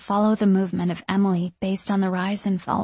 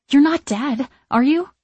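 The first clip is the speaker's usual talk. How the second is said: high, louder anxious question; panicked; 6 semitones higher, 5 dB louder than usual. A narrator's reading. Niece fearing her aunt has died, high and panicked. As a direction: blurt it with rising panic. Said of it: "An unexpected panic hits me".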